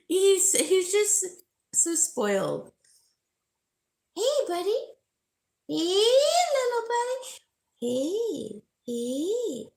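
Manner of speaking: high, loud calling for a pet's attention. Real, not acted. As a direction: call the dog over, high and eager. Calling her spoiled dog.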